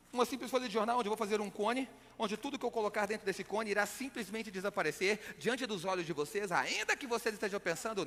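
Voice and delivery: normal talking voice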